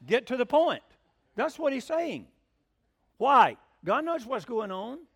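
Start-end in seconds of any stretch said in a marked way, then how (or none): 0.1-0.8 loud, blunt call to hurry; impatient demand. Retold or imitated. Man in the shop interrupting the praying preacher, loud impatient demand. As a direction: cut him off bluntly.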